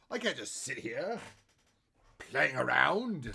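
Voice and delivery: drawn-out, theatrical villain lilt; a hint of cartoon villain, stretched slow; slipping into the Joker. Real, not acted. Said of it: "I sound like the Joker".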